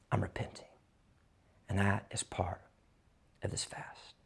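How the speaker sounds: hushed, intimate whisper; a pastor whispering conviction to his church. Acted. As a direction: lean in and whisper it. Preacher drops to intimate whisper.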